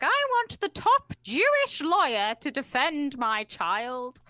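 drawn-out royal command; mock Queen. Imitating the Queen demanding a top lawyer, slow and lofty.

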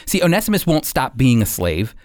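ordinary talk

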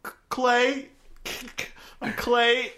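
high, stammering fake nervousness; hesitant, high-pitched put-on introduction; pretending to be scared. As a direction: imitate a bad liar playing frightened. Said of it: "he's, like, pretending and shit".

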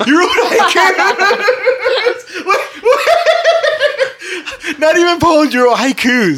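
high, loud, laughing disbelief. Real, not acted. Incredulous laughing at haiku confession.

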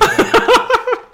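high shrieking laugh burst; loud laugh. Real, not acted.

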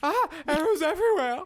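high, loud, quick alarm; panicked yell. Acted. Wizard under arrow fire, panicked yell. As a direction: cry out as arrows come flying.

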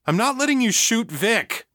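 high-pitched, heated refusal; agitated refusal. A narrator's reading. Egan holding back the armed mother, agitated refusal.

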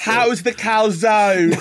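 a cheery Aussie asking about food; Australian accent. Retold or imitated. Cohost continuing the bit, slow Australian accent. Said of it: "saying Italian dishes in an Australian accent".

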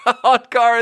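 cracking up at his own joke; laughing. Real, not acted. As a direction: blurt it out through a high laugh.

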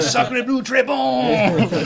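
high shouted mock-French exclamation; French accent.

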